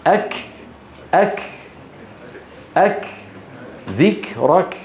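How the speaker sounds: أك cut short at the k, in separate bursts; soundless kaf. Retold or imitated. Teacher repeats the mute-kaf mistake for students, soundless. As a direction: end ذكرك on a blocked k. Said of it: "الصماء يعني ما له صوت".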